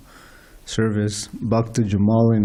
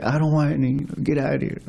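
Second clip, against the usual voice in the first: higher-pitched imitation of an irritated man; retold brush-off; 4 semitones higher, faster than usual. Retold rude brush-off at the door.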